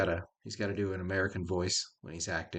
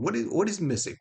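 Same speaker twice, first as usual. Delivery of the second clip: high, insistent puzzled question; exasperated searching; 8 semitones higher than usual. Retold or imitated. Asking himself why the feeling is absent, exasperated. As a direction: press the question, pitched up. Searching aloud for what is missing.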